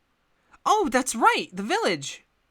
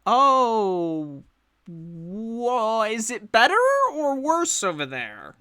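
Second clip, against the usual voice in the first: Deborah voice; 6 semitones higher, much slower than usual. Acted. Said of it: "She says".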